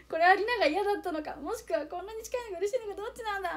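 高めの作り声で迷う; 高い声でぐるぐる悩む; 混乱した心の声. Retold or imitated. (高い作り声で迷う)

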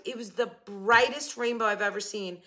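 ordinary delivery

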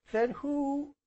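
put-on high child's question, left hanging; small boy. A narrator's reading. Small boy about to question uncle, high voice. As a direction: start asking high, as a little boy.

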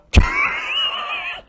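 sudden shrieking laugh, far above the voice; real giggling shriek; high laugh. Real, not acted. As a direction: burst into a squealing laugh. Laughs at own joke.

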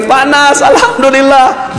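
high, joyful thanks; happy guest. Retold or imitated. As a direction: sing out gratitude like a relieved man. Overjoyed guest returns.

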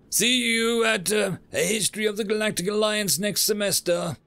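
high, sing-out parting call; calling after someone. Acted. Professor getting the final word in, calling out. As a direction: pitch it up and call it down the hall.

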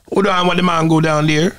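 put-on self-declared terrible Jamaican accent. Interviewer asks for a true one, comic tries Jamaican accent. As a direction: attempt a rough Jamaican accent. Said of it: "My Jamaican accent is terrible".

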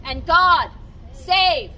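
loud ceremonial cheer; rousing proclamation. Acted. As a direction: ring out the royal toast.

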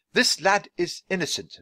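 high, loud declaration of innocence; indignant Dickens. Acted. Dickens defends the lad before a crowd, indignant. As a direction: proclaim it to everyone within earshot.

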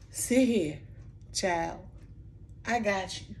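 a hint of a kind teacher, soft and slow; gentle reassurance. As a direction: quietly comfort a hurt child. Teacher comforting child, retold.

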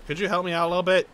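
ordinary delivery

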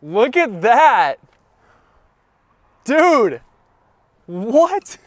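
high, loud and slow in awe; thrilled. Real, not acted. Watching roof close, thrilled.

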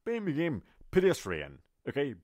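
ordinary delivery

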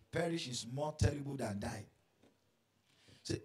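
loud, driving sermon delivery; forceful preaching. Acted. Preacher driving home the lesson loudly to the church. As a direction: preach it out to the congregation.

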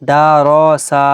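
দা, র and সা each stretched long; wrongly drawn out. Shown as a demonstration. Teacher shows how never to read it, drawn-out syllables. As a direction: drag every vowel of দা র সা. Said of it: "এরকম একদমই পড়া যাবে না".

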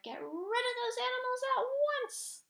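loud roar pitched far up; high, full-voiced bellow of a furious stage manager; angry roar. A narrator's reading. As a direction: roar the order across the theater. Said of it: "Klink roared".

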